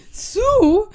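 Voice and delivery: high, loud, incredulous echo; mock shock. Woman retold hearing about being sued, mock shock. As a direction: blurt it out in hammed-up disbelief.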